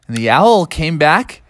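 plain reading voice

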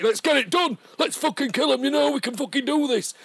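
high, loud, hyped-up Sheffield lad; panicky soldier. Retold or imitated. Soldier rallying the crew, loud and panicky. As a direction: pump the team up in a panic. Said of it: "this Sheffield lad who's a bit panicky".

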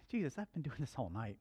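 weary protest. Retold or imitated. Peter to Jesus after fishing all night, soft weary protest. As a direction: object under your voice, as worn-out Peter.

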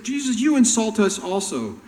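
loud, high, indignant outburst; heated quote. Retold or imitated. Jesus mocking the lawyers' complaint, loud and heated. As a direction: throw their complaint back hard. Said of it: "when they said".

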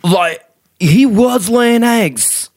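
high, slow mock Southern drawl; a lazy Southern farmer making excuses; Southern accent. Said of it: "I'm using a Southern accent for Jersey".

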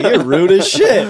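high, loud and slow through laughter; laughing accusation. Retold or imitated. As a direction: call her rude while giggling. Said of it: "she's like".

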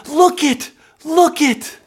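high, loud, joyful shout. Acted. Pastor urging all to point at God's work, excited shout. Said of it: "we should all be shouting".

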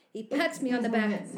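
usual speaking voice

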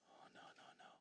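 whispered denial; hushed no. Acted. Preacher answering his own list, whispered no. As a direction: drop to a whisper, shaking it off.

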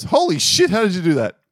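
high-pitched comic astonishment; amazed bartender. Acted. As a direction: exclaim in hammed-up disbelief.

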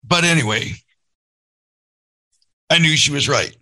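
fighting back tears, pushing the words out; choked up. Real, not acted. Dad recalling his daughter's text, choked up with real emotion. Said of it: "Why am I getting emotional?".

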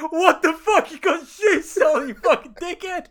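high, laughing mock outrage. Friends laughing at him for sulking over stolen shoes. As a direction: hurl the insult while cracking up. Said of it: "we're all like".

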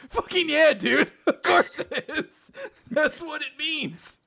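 high-pitched, laughing disbelief; exasperated mock reply. Crew answers the hungry captain, laughing exasperation. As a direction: point out the obvious through laughter. Crew's acted reply to obvious statement.

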